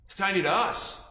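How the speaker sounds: usual speaking voice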